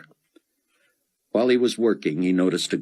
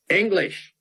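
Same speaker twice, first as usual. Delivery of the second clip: hollered. Acted. Buck calling out to the officer, a high holler. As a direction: call "English!" out high toward the squad car. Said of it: "Buck held out both hands and hollered".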